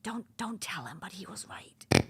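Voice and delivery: hushed, barely voiced aside; secretive whisper. Client confiding that the coach was right, secretive whisper. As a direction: whisper it like a reluctant confession. Confiding a grudging admission behind his back.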